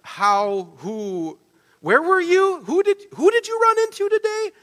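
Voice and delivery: high-pitched, incredulous string of questions; stuttering excitement. Acted. Astonished Naomi firing questions at Ruth, stuttering with excitement. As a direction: sputter questions in rising, squeaky disbelief. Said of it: "like this stuttering of excitement".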